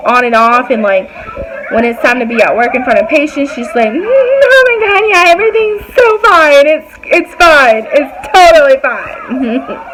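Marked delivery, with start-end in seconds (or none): 3.9-9.8 fake-sweet attitude. Coworker switching on in front of patients, mock fake-sweet. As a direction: imitate a coworker switching on gushing niceness. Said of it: "Like that attitude".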